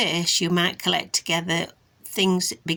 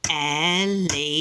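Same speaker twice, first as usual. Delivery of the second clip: syllable by syllable; 2 semitones lower than usual. Shown as a demonstration. Trying the taps again for a child, syllable by syllable. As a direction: say elephant in three stretched, even beats. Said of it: "Let's try again".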